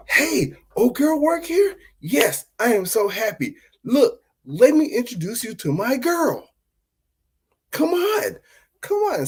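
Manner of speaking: high, giddy, gushing greeting; overjoyed boyfriend showing off his girl; overexcited guy. Retold or imitated. Boyfriend gushing at his girlfriend, squealing high and excited. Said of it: "this is how it went".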